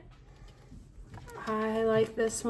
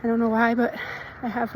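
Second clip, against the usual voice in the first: ordinary talk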